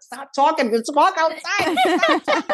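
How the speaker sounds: high, urgent plea through laughter; mortified hushing. Retold or imitated. Embarrassed daughter hushes mother at the pedicure, mortified. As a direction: pitch climbing as you try to shut someone up.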